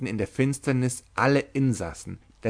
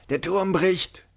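high panicked shout; screamed warning; 8 semitones higher than usual. A narrator's reading. A voice in the flooding tower screams, panicked shout. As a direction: yell it out in terror, pitch way up. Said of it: "schrie eine Stimme".

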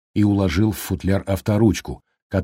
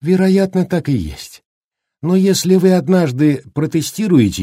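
lightly voiced doctor, lively explanatory delivery; a hint of a doctor giving his verdict; measured doctor; 6 semitones higher, 4 dB louder than usual. A narrator's reading. Doctor explains the boy's health to his parents, lightly voiced doctor.

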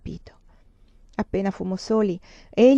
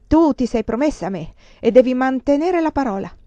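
loud, higher-pitched peremptory demand; perentorio e risoluto; 4 semitones higher, 6 dB louder than usual. A narrator's reading. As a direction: insist on the promise, resolutely. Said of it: "così perentorio e risoluto". (pretesa perentoria, a voce alta)

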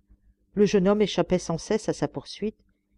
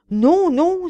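loud, very high cry of refusal; anguished cry; 12 semitones higher, 9 dB louder than usual. A narrator's reading. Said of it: "s'écria la malheureuse gouvernante avec angoisse".

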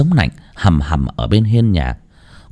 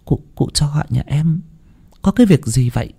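hushed, higher, stammering voice; anxious servant hesitating over the words; faltering, timid question; 5 semitones higher, 4 dB quieter than usual. A narrator's reading. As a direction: ask it softly and haltingly. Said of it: "miệng ấp úng".

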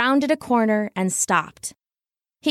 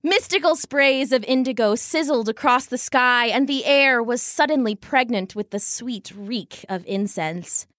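plain reading voice

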